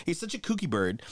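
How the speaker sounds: ordinary delivery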